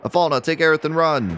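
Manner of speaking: high, strained call to flee; urgent warning. Acted. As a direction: cry out to save your family. Father urges wife to flee.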